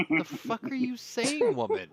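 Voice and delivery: high, loud, slow mock confused growl; squinting Clint Eastwood. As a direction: drag it out, baffled and squinting. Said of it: "he squints like Clint Eastwood".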